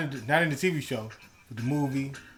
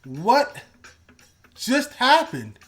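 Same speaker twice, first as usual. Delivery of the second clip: angry; 9 semitones higher, 5 dB louder, much slower than usual. Retold or imitated. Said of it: "I was legit angry".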